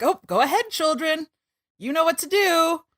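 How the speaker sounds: high, indulgent parent voice; delighted parents. Retold or imitated. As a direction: urge the children on in a high, sweet lilt. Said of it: "the parents are delighted".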